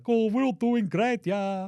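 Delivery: high, goofy put-on voice of a dim big brother; dopey brother. Mocking Franz's big brother, high goofy voice. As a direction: play the clueless big brother, high and cheerful. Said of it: "his big pumpkin-headed brother's like".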